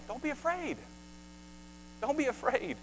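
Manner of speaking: soft, tender plea; gentle reassurance. Acted. Jesus calming his disciples, soft and tender. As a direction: say it like a quiet comfort.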